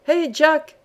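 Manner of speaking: calling out. A narrator's reading. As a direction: call down the shaft to him. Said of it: "cria-t-il".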